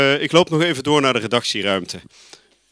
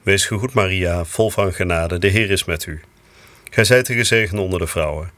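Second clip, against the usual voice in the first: low, even murmured Hail Mary; prayer recitation; 4 semitones lower than usual. Real, not acted. Priest leads the Angelus on air, lower prayer recitation. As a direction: recite the prayer steadily, devoutly.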